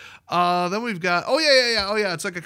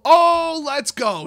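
loud, high burst of excitement; real whoop of delight, starting high and loud; excited cheer; 9 semitones higher, 7 dB louder, much slower than usual. Real, not acted. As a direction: cheer as the long-awaited card turns up. Cheers at finding his blue cow.

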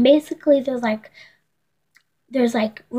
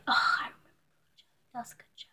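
whisper; 12 dB quieter, much faster than usual. Real, not acted. Whispered embarrassed asides.

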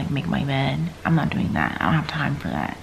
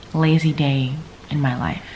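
ordinary talk

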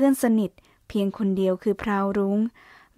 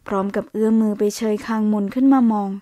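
usual speaking voice

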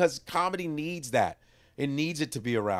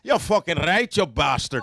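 loud, brash Irish insult; Irish accent; 7 dB louder than usual. Acted. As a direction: curse in a put-on Irish accent. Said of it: "or my Irish".

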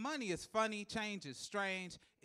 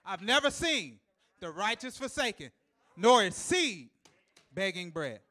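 louder, rising to a shout on begging bread; shouted psalm quote; 2 semitones higher, 8 dB louder than usual. Acted. Preacher quoting David, loud proclamation. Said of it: "David said it this way".

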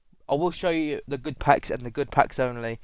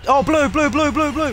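much higher, much louder yelling; excited shout; 13 semitones higher, 11 dB louder than usual. Real, not acted. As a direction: scream it out in excitement. Sees a blue rare card.

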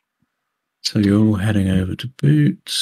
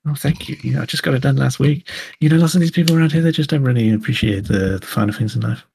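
put-on higher, fawning young tech complimenting hair; gushing Pip; 5 semitones higher than usual. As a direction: gush over her, gossipy and a bit smug. GM plays Pip.

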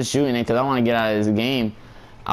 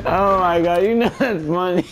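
high-pitched, giggling amazement; laughing hard; 7 semitones higher than usual. Real, not acted. Streamer tickled by his own joke, laughing hard. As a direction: talk through real laughter. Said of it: "I'm laughing so hard".

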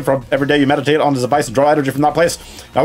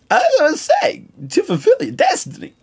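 ghostly voice. Acted. Ghost repeats prophecy, louder.

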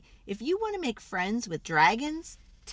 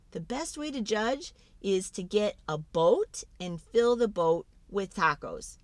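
ordinary delivery

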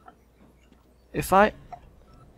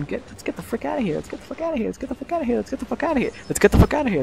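frantic repeated muttering; 9 semitones higher, much faster than usual. Real, not acted. Player urging himself to escape danger, frantic high patter. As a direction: repeat it in a rush as you flee.

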